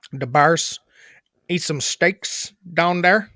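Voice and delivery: thick Chicago "da Bears" talk; Chicago accent. Shown as a demonstration. Speaker voices a Bears fan, thick Chicago accent. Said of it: "the Bears shit?".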